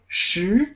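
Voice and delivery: "Shi" rising as if asking a question. Shown as a demonstration. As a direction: lift the pitch through "Shi", second tone. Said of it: "So it's a rising tone".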